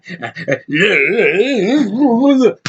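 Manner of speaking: long wobbly wail, rising and falling; mock babbling noises. Reviewer imitating the lead through the whole movie, mock wailing. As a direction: babble and moan like a hammy panicking actor. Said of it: "That's him the whole freaking movie".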